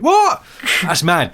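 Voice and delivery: high, loud mock disbelief; hammed-up presenter yell; astonished shout. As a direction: explode with over-the-top amazement. Mimicking loud amazed presenter.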